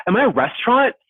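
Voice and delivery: a mock-furious am I a restaurant; outraged disbelief. Outraged at houseguest's request.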